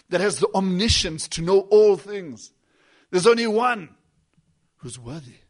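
rising, hammering preacher cadence, stress on only; climbing pulpit proclamation on only; full-force preaching. Acted. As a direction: preach it harder line by line.

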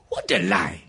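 ordinary delivery

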